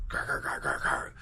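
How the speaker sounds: low, guttural grunting growls; low frustrated growling; angry growl. Retold or imitated. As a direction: growl like an angry bear retreating. Dad growling back to garage.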